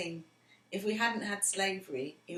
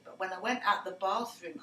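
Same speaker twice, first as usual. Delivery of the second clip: ordinary talk, nothing marked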